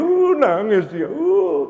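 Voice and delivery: long, loud mock wail of fright; imitated frightened wail. Preacher mimics someone hearing a ghost, mock frightened wail. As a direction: wail as if scared to tears by a ghost. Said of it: "Dengar suara begitu".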